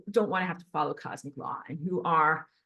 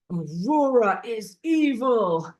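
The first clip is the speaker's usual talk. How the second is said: slow, loud mock accusation; 3 semitones higher, 6 dB louder, slower than usual. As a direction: intone it like a stern preacher condemning her.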